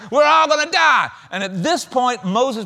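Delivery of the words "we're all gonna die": mock panic. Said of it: "They're imagining the worst".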